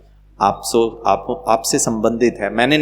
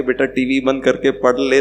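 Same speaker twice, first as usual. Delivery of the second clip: usual speaking voice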